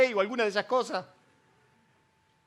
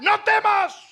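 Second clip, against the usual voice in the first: loud reassurance; 8 semitones higher, 4 dB louder, slower than usual. Acted. God answers loudly.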